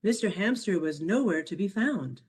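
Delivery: ordinary delivery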